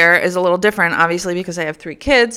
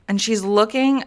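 ordinary talk, nothing marked

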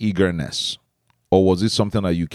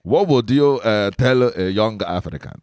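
put-on Igbo interviewer's accent. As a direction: ask it as a playful Igbo man. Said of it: "let me switch into my accent".

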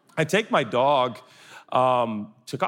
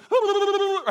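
high burbling excited dog noise; high-pitched mock puppy babble; imitated dog frenzy. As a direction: go crazy like a puppy hearing "walk". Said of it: "he loses his mind".

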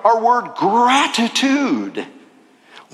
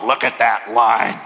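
low, grumbling complaint; annoyed grumble; 9 semitones lower than usual. Retold or imitated. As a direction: sigh it at the long gas line. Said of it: "and go".

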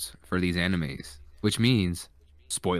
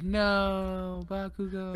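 high, soft dubbed line; mock a limp English dub; 11 semitones higher, 4 dB quieter, much slower than usual. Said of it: "They'd be like".

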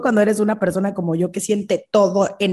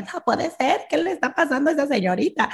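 higher, fast, mock scandalized diner; mock shocked onlooker; 7 semitones higher than usual. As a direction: gossip in a higher voice. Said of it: "con cara de". (comensal escandalizado, más agudo)

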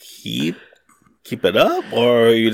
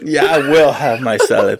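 laughing; 3 semitones higher, 6 dB louder than usual. Real, not acted. Laughs while agreeing to salad.

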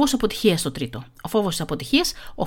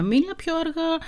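imitating the drama teacher in a higher voice; higher-pitched quote, rising into the last word; Νάντια; 8 semitones higher, 3 dB quieter, slower than usual. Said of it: "Μου λέει η Νάντια". (μίμηση δασκάλας με πιο ψηλή φωνή)

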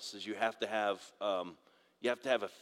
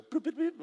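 high chirpy boop sounds, much higher; mock map beeps. Dad imitating Google Maps beeping at the congregation, high chirps. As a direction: chirp the beeps like a phone app. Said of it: "Google Maps will just go".

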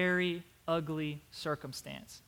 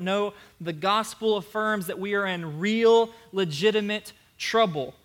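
preaching at full force; 4 semitones higher, 7 dB louder than usual. Acted. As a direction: drive the point home to the church.

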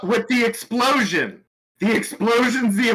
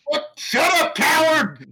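yelling back; 8 semitones higher than usual. Acted. Character yells back at trapped screamer.

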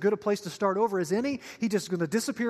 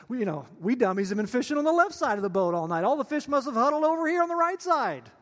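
sarcasm climbing to a high, loud finish; exaggerated fake amazement; mocking sarcasm; 3 semitones higher, 3 dB louder than usual. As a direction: build mock realization up to a high pitch. Fishermen's sarcastic reply, rising.

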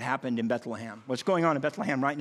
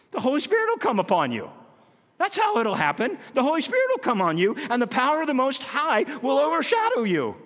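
exultant proclamation; 9 semitones higher, 4 dB louder than usual. Pretend. Angel's answer voiced.

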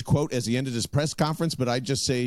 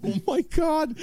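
much higher, stunned exclamation; overwhelmed; 13 semitones higher than usual. Real, not acted. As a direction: voice jumps up in awe. Overwhelmed by the realization.

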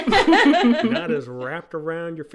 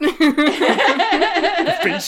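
laughing; 14 semitones higher, 5 dB louder than usual. Real, not acted. Group laughing at Bracken.

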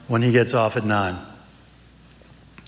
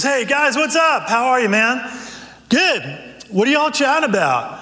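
a much higher, bright, cheery greeting; cheery pastor; 10 semitones higher than usual. Acted. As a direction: greet the guys warmly, pitched up. Pastor friend arrives.

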